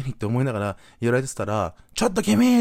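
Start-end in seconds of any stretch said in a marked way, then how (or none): 1.9-2.6 imitating a high, loud scolding call; acted-out indignant shout; older man calling out. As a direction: call out sharply, high and loud, as a meddling stranger. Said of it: "っておっさんの声が聞こえたの".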